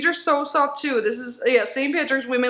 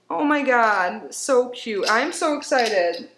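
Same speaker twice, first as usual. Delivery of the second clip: ordinary talk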